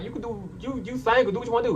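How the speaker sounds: high-pitched dismissive retort; sassy. Retold or imitated. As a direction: toss it off with attitude. Retorting to boyfriend asking permission.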